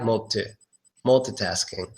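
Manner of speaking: "multi" and "multitasking" with a reduced mul-tuh; TUH ending. Shown as a demonstration. Teacher contrasts endings, multi with unstressed TUH. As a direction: say "multi" with a short, weak final schwa. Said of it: "three ways to say that word".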